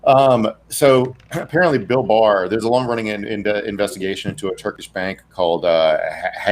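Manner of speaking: ordinary talk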